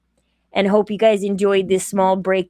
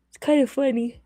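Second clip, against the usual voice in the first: yawning; 5 semitones higher, faster than usual. Real, not acted. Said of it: "sorry for yawning".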